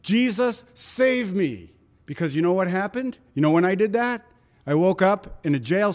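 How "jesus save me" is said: raised, desperate call to Jesus; retold cry for help. Preacher reliving his jail cell plea, high urgent cry. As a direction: cry out as if sinking.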